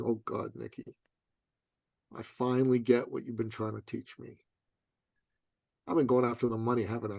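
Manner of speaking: quietly voiced sheepish client; sheepish confession. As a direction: sound embarrassed, owning up quietly.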